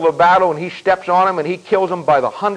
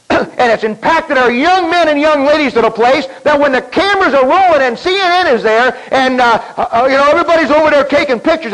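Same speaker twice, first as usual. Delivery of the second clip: full-force preaching; 8 semitones higher than usual. Acted. Preacher warning the congregation about the war, at full force. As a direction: build the fire under every phrase.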